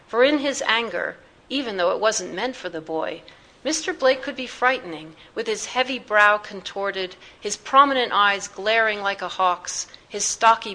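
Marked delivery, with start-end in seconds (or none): none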